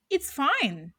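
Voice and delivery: usual speaking voice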